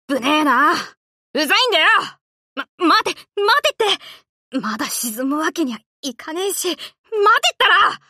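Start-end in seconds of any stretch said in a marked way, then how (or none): 2.5-8.0 high, strained, pleading; frantic wait cries; desperate plea. Acted. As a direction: panic as she sinks. Sinking, pleading.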